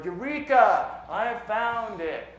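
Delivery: loud, high Eureka shout, drawn out; exaggerated triumphant exclamation; mock triumphant cry. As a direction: proclaim it like a grand discovery, hammed up.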